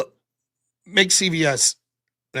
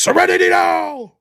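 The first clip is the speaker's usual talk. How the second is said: shouted catchphrase; 8 semitones higher, 7 dB louder, slower than usual. Acted. Reading a superchat as shouted catchphrase.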